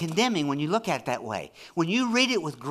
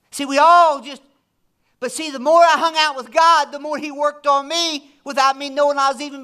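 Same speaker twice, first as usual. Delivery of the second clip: raised, emphatic preacher voice; impassioned preaching; 10 semitones higher, 9 dB louder than usual. Real, not acted. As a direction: preach it hard, punching key words. Preaches with force.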